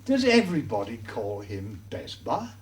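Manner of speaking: ordinary delivery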